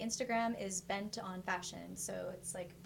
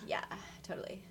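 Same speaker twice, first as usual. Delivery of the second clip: put-on creaky valley girl "totally"; draggy preppy fry; preppy vocal fry; 4 dB quieter than usual. Shown as a demonstration. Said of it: "when you sound really preppy".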